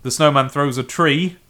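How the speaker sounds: normal talking voice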